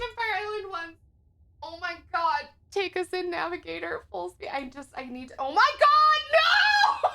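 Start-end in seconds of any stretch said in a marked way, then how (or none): 5.4-7.1 high, loud shriek through laughter; laughing shout. Real, not acted. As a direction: yelp it while cracking up. Can't bring herself to say line.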